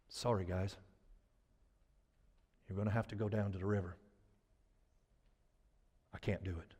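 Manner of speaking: low, very soft, deflated; acted sheepish admission of failure; defeated apology. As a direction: mumble it low and small. Joshua admitting he can't.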